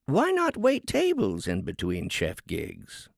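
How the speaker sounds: high, sing-song harpy voice, preaching down to the crowd; smug harpy lecturing. A narrator's reading. Harpy lectures the cooks at length.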